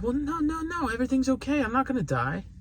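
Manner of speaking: high, soft soothing dad; reassuring father. Retold or imitated. Father calming his crying daughter, softly reassuring.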